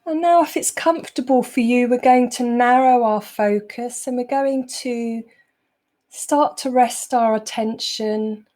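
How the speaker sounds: unhurried meditation voice, long pauses between phrases; guided meditation. Acted. Teacher easing the group out of a busy day, guided meditation. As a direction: guide them calmly into the present moment.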